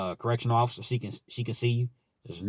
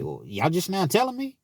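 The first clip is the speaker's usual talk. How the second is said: incredulous question climbing high; retold protest, pitch rising sharply; higher, affronted questioning; indignant disbelief; 8 semitones higher than usual. Retold protest at late notification.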